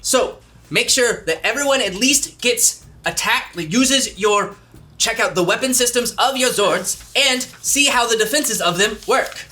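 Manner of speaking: high, quick robot sidekick; Alpha's voice. Acted. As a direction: chirp it high like a little robot. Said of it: "you kinda hear in the intercom Alpha's voice".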